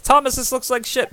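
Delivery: high, loud blurt of disgust; mock disgusted smith. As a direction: squawk out the insult to his brother. GM voices smith insulting scrap.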